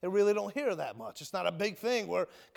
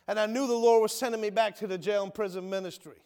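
ordinary delivery

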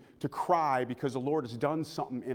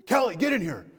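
high, loud acted call to another room; 7 semitones higher, 5 dB louder than usual. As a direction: summon someone urgently, raised voice. Father calls wife into bedroom.

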